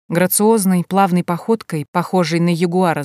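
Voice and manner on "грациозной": usual speaking voice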